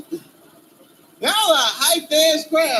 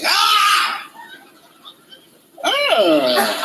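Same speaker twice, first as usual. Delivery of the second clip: mock panicked yelps; 7 semitones higher than usual. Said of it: "you be a man like".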